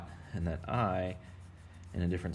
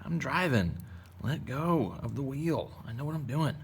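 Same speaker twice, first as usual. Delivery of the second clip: higher-pitched, swooping self-parody; mock breezy reassurance; 7 semitones higher than usual. Teacher answering a worried pupil about the drawing, mock breezy confidence. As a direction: play the overconfident driver waving off worries. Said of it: "And I'm like".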